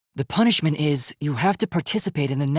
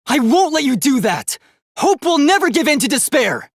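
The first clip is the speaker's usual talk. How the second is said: fierce defiant outcry; 6 semitones higher, 3 dB louder than usual. Acted. Robot boy defying the mastermind in the trial, fierce loud resolve. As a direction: raise the voice, defiant and rising high.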